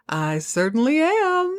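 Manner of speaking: high, slow deadpan drawl, lightly voiced; mock smug drunk, slow and dry; deadpan drunk Earl. Drunk Earl snubbing Val's greeting, slow smug deadpan. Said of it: "And he goes".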